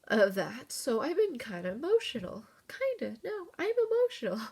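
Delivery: fighting back real tears as the memories come; choked up, pitch climbing as she tries not to cry; real tearful voice. Real, not acted. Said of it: "sorry, I don't wanna cry".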